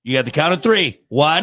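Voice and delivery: firm parent warning; stern countdown. Real, not acted. Counting down at child.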